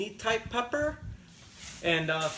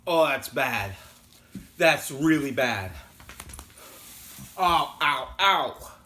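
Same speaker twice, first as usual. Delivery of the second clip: pained, tongue burning; strained through the burn; mouth burning from spicy food; 3 semitones lower, slower than usual. Real, not acted. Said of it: "that hurts".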